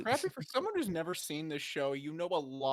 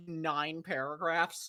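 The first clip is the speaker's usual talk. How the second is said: ordinary talk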